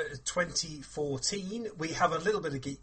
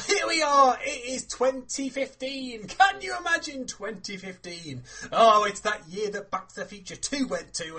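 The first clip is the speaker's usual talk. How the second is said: loud, high excited opener; bursting with new year energy; 6 semitones higher, 7 dB louder than usual. Real, not acted.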